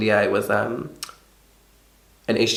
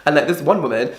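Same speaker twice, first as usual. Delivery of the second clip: ordinary delivery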